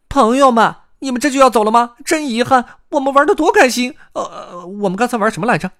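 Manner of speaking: high, loud, excitable hare voice; 野兔朗朗. A narrator's reading. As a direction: call after departing friends in a squeaky voice. 野兔送别朋友. (尖声挽留朋友、健忘的野兔)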